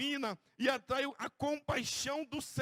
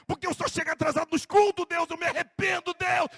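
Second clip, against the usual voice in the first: higher, louder cry of confession; loud repentant plea; 3 semitones higher, 6 dB louder than usual. Pretend. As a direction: plead with God, rising in anguish. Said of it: "modelled confession". (confissão clamada, aguda e forte)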